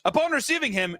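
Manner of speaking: ordinary talk, nothing marked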